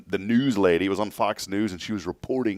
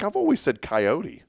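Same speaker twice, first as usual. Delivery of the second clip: retold protest; 10 semitones higher, 3 dB louder than usual. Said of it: "I'm like".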